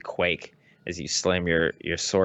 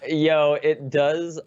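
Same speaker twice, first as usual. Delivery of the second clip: slow, higher emphatic yes; emphatic agreement; 6 semitones higher, 4 dB louder, much slower than usual. Real, not acted. Co-host agreeing the plant is a joke, drawn out. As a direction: lean hard into the agreement.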